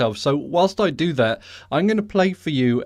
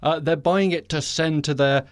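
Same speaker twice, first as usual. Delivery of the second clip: usual speaking voice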